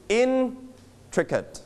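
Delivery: "intricate" with IN high and drawn out; stress on first syllable. Shown as a demonstration. Teacher shows the class the stress, first syllable of "intricate" high. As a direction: split "intricate" after a long, high IN. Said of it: "we put the stress on which syllable".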